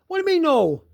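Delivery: high-pitched mock disbelief; incredulous retort. Retelling his reply to relatives who refused, incredulous.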